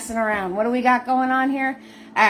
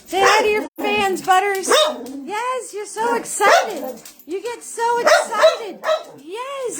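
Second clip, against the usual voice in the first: high dog-talk voice; 9 semitones higher than usual. Real, not acted. Owner cooing to her excited dog Butters, high baby talk.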